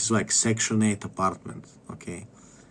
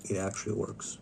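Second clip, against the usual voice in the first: much softer, almost under the breath; soft repeat; 11 dB quieter than usual. Real, not acted. Repeating the claim to himself, quietly. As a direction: let it drop to a quiet murmur.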